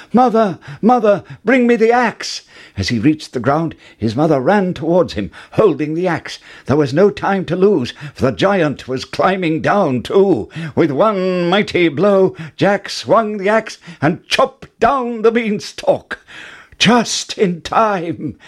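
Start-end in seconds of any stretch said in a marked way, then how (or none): 0.1-2.4 much higher, loud urgent call; frantic high call to mother. A narrator's reading. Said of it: "called out". 16.8-18.4 excited cry. A narrator's reading. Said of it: "cried Jack".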